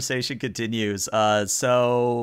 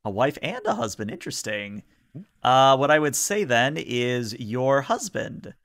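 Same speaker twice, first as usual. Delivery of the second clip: usual speaking voice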